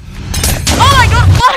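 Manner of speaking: high, loud alarmed cry; alarmed outburst. Real, not acted. Startled by a sound mid-game, high loud cry. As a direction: blurt it out in shock.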